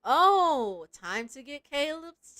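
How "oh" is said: ordinary talk, nothing marked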